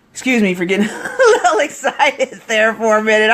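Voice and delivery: breaking into a laugh mid-sentence; laughing apology. Real, not acted. Coach excusing her excitement to viewers, laughing. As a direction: apologize while laughing at yourself.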